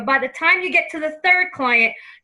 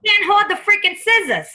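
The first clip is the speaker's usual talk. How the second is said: New York grandma, pushed louder; New York accent; 5 semitones higher, 3 dB louder than usual. Acted. Medium voicing grandma's complaint about hands, New York accent. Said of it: "she's saying it with the New York accent".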